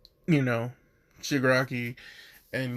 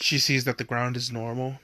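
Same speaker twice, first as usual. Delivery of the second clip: normal talking voice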